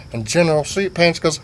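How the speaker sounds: ordinary talk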